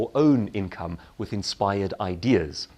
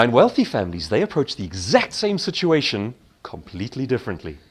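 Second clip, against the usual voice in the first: ordinary talk, nothing marked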